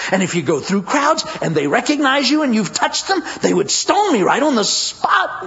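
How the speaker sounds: high-pitched, worried protest; anxious inner debate. Acted. Untouchable woman dreads the crowds, high and anxious.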